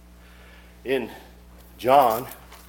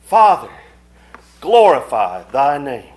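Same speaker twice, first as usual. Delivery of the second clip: ringing, emphatic prayer; fervent prayer; 3 semitones higher, 12 dB louder than usual. Retold or imitated. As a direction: pray it out forcefully to the end. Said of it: "That's a prayer".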